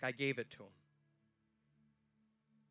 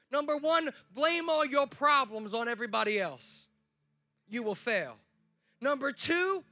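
louder and higher preacher's exhortation; preaching; 5 semitones higher, 4 dB louder than usual. Acted. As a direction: drive each point home to the crowd. Preaching at full force.

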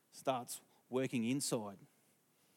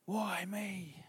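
plaintive; 6 semitones higher, 5 dB quieter than usual. Retold or imitated. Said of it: "He's been waiting".

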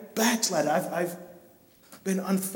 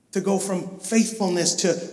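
normal talking voice

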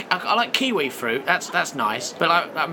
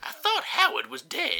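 ancient man; 9 semitones higher, 4 dB quieter than usual. Acted. Ancestor voice continues.

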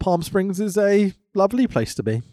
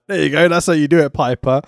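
quick smug instruction; 3 semitones lower, faster than usual. Retold or imitated. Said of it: "be like".

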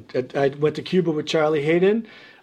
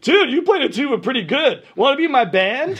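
high, enthusiastic invite; eager recruiting; 9 semitones higher, 4 dB louder than usual. Retold or imitated. Musicians inviting the tuba kid into a band, eagerly. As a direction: pitch it up like an impressed bandmate.

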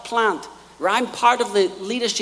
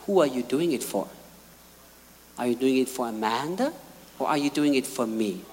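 the Lord's voice; 8 semitones lower, 4 dB quieter than usual. Retold or imitated. The Lord asks Candy whom she serves, low gentle questioning. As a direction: ask gently but searchingly.

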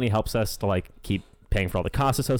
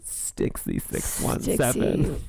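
sexy whisper; much slower than usual. Retold or imitated. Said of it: "joining the sexy name read".

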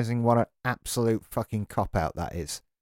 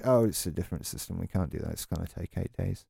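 the same lightly mocked agent line, fast, softer and lower; mocking support agent, repeated; 5 semitones lower, 7 dB quieter than usual. The ranter repeats the support staff's stock excuse, rattled off fast and softer. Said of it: "we just repeat the same thing over and over again".

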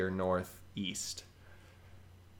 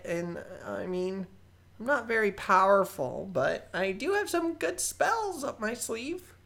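higher, eager nervous goblin rambling; young goblin sorcerer voice; 12 semitones higher, 3 dB louder than usual. Acted. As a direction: play a keen but unsure goblin kid. GM holds Balthazar voice.

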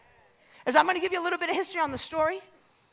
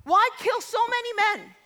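high, raised battle taunt; big booming challenge, pitched up; giant's yell; 8 semitones higher, 3 dB louder than usual. Acted. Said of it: "he begins to yell to Israel".

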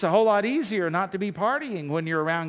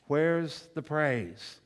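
drawn-out question; much slower than usual. Acted. Punchline after crickets.

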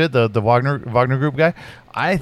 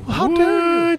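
mock outrage; 14 semitones higher, much slower than usual. Mock offense at another chat.

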